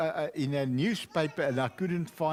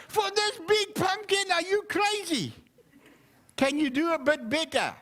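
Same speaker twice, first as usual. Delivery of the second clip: haggling seller; 12 semitones higher than usual. Acted. Haggling, outraged at low price.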